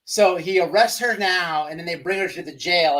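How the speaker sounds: ordinary talk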